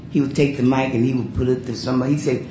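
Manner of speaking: ordinary delivery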